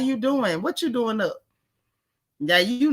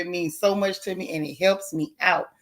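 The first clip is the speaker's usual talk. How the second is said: ordinary delivery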